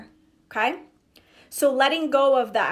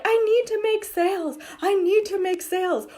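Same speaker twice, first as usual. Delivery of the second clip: pitched way up, frantic and needy; mock panic; 8 semitones higher than usual. Coach mimics a panicking seller, frantic and high. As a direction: play the coach's client panicking over money. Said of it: "you're freaking out about money".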